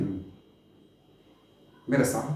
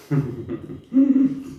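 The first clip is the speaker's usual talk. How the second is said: closed, drawn-out little laugh; amused chuckle; 3 semitones lower than usual. Acted. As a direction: chuckle indulgently at the youngster. Ruler amused by young listener.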